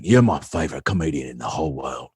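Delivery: low, soft tipsy confession; imitated drunk fan. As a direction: imitate a hammered admirer, voice dropped low. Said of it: "he comes up and he's hammered".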